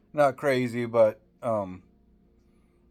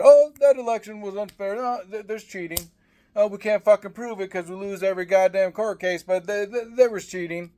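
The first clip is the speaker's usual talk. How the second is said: much higher, whining mockery of election denial; mock whiny sore loser; 8 semitones higher than usual. Ranter mocking an imagined Democrat crying election fraud, high whiny mock voice. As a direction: squeal it like a petty crybaby.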